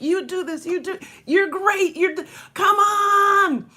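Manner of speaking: rising to a high, loud cheer; climbing cheer that ends in a shout; cheerleader hype. Retold or imitated. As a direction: pump the kids up, building. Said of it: "I was their best cheerleader".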